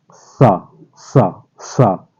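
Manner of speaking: सा with the tongue against the teeth, repeated; dental s. Shown as a demonstration. Teacher drilling dental सा for students. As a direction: hiss सा off the teeth. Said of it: "दांतों से जीभ लगाएंगे".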